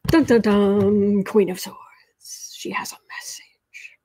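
slow dramatic whisper; spooky suspense-music hum then whisper; horror host. Acted. Dramatic spooky card reveal.

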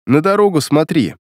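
ordinary delivery

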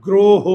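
গ্রহ loud, as a drawn-out "gro-ho"; pronunciation model. Shown as a demonstration. Teacher checking the spelling with the class, গ্রহ loud as "gro-ho". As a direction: project গ্রহ with two rounded "o" vowels. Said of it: "ইংরেজিটা এরকম হয় না".

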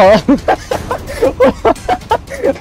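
long high-pitched laughing fit; real uncontrollable laughing; helpless laughter. Real, not acted. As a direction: crack up in a high wheeze of laughter. Laughing at crying child.

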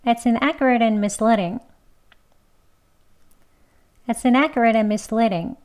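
fast. Shown as a demonstration. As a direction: run inaccurate and together. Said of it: "두 번 빨리".

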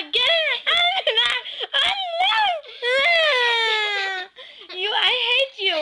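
laughing. Real, not acted. Laughing through mock insult.

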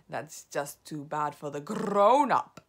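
slow, loud witch leader. A narrator's reading. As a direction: dismiss it slowly and heavily. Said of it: "said the Grand High Witch".

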